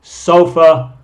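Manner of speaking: "Sofa" said out loud, the final a lax; loud model word. Shown as a demonstration. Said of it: "Recuerden el schwa".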